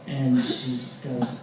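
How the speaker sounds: ordinary talk, nothing marked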